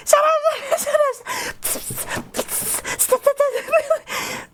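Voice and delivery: high, laughing shut up, then whispered stops; panicked hush. Retold or imitated. Hushing fans blasting her name through the con, laughing panic. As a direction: beg them to stop, half laughing, half hushing. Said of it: "I have to go like".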